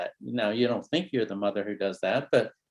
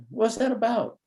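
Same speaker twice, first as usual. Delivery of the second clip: ordinary talk, nothing marked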